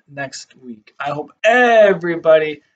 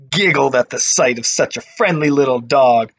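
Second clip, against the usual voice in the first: usual speaking voice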